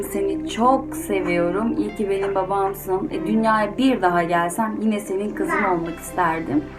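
plain reading voice

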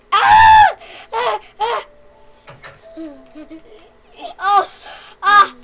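high panicked screams; screaming. Real, not acted. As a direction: scream as you get hit. Attacked in game.

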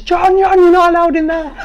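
high, mimicked nurses calling after him; mock nurses calling. Nurses calling the cleaner back from the doorway. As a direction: squeak it out like fussing nurses. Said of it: "I heard the nurses".

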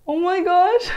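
loud, high rising gasp; shocked outburst; startled exclamation. Real, not acted. Reacting to the fallen clip.

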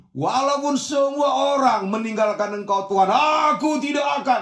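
overacted pledge of loyalty, swelling to a cry; bombastic boast. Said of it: "ini kan sering gaya-gaya kita ini". (ikrar sok setia, lantang dan bernada tinggi)

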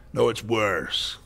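ordinary delivery

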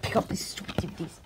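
breathy whisper under the breath; whispered grumble. Acted. Sonic grumbling to himself about picking up the mess. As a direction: grumble it so nobody hears.

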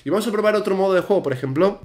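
ordinary talk, nothing marked

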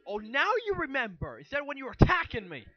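mother turning on her son, angry and loud; angry accusation. Acted. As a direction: lash out at him in sudden anger. Confused mother turns on son.

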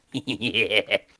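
loud evil villain cackle; demonic laugh. Pretend. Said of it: "T'aimes-tu mon rire démoniaque?".

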